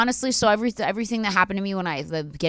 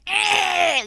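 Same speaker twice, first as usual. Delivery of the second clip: put-on excited kid squeal; 12 semitones higher than usual.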